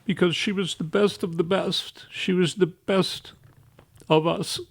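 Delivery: soft, high, tear-choked voice, slowing down; barely holding it together, grieving; weepy. Real, not acted. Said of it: "that I'm so weepy".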